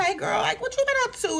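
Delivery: high, sugary greeting; mock sugary girlfriend voice; sweet Angela. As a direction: ask sweetly. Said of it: "Angela asks Shamia".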